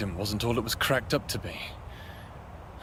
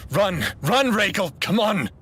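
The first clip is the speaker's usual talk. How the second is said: frantic self-urging, much higher and louder; 10 semitones higher, 5 dB louder than usual. Acted. Runaway fleeing danger, frantic high shouts. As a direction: yell at yourself to flee.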